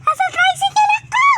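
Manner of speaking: very high rising shout; high calling shout. Real, not acted. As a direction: yell it out, rising at the end. (mataas na sigaw)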